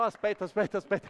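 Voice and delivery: ordinary talk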